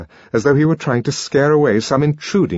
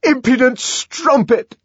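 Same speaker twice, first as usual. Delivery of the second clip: loud, high-pitched raging insult; enraged shouting; furious shout; 6 dB louder, slower than usual. A narrator's reading. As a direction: hurl the insults at full voice. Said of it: "he shouted".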